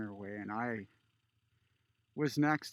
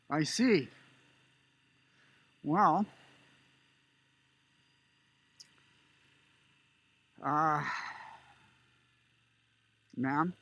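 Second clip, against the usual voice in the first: stern scolding; 3 semitones higher, 4 dB louder than usual. Retold or imitated. Older border guard scolding a Canadian woman, stern and loud. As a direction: put her firmly in her place.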